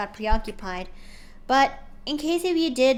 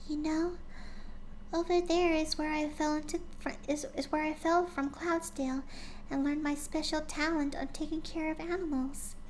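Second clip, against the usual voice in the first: high, soft gentle pony voice; a shy filly remembering, softly; soft, timid Fluttershy; 6 semitones higher, 6 dB quieter than usual. Acted. Fluttershy voiced in fanfic reading.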